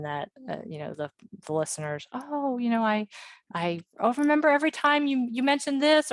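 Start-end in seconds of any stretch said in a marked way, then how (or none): 2.1-6.1 higher-pitched imitation of an eager fan; eager listener. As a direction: lightly act out a listener gushing to their favorite host. Voices listeners greeting a podcaster.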